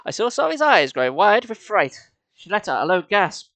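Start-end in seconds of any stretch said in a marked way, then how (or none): none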